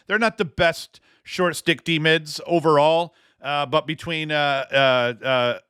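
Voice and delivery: usual speaking voice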